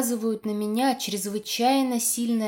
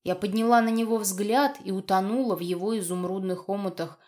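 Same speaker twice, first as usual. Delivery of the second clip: plain reading voice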